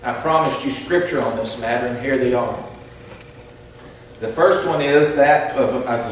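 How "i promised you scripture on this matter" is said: usual speaking voice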